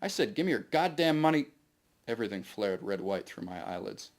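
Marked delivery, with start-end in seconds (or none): 0.0-1.5 higher, louder furious demand; shouted threat over a pointed gun; angry yell. Acted. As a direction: bark it like a gunman at the end of his rope. Gunman yelling demand.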